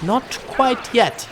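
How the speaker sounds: NOT QUITE YET stretched slow and high; teasing drawn-out delivery. Pretend. Teasing viewers about a surprise.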